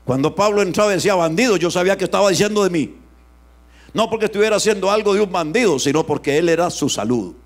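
loud, fast, driving pulpit voice; fiery preaching. Acted. Preacher to his congregation, loud and fast. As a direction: preach it out to the whole church.

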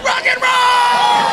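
high, slow drawn-out shout; rock and roll cry. Real, not acted. Comedian hollering to the audience, slow triumphant shout. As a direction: stretch it like a crowd cheer.

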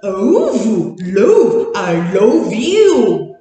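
love, love sounded out very slowly as if stuck; a mock struggle to sound out love; slow groping attempt. Shown as a demonstration. Teacher acting a child trying to spell love by phonics. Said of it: "你试着用phonics rule去拼".

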